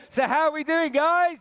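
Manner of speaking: high, loud call to the crowd; crowd hype shout. Real, not acted. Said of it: "I need a bit more energy than that".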